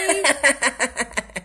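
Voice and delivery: loud, falling laugh of triumph; a spoiled girl's nasty laugh; gloating laugh. Acted. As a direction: cackle smugly after the taunt. Bully gloating at locked door.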